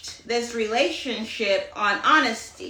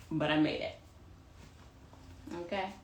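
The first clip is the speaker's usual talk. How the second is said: normal talking voice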